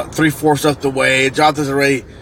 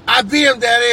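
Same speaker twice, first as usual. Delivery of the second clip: high-pitched imitated kid boast; triumphant child; 8 semitones higher than usual. Son announcing victory to Daddy, imitated high boast. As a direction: mimic a little boy calling out his win.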